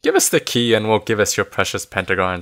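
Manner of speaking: ordinary delivery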